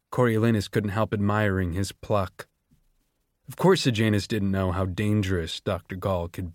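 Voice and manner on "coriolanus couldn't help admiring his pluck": normal talking voice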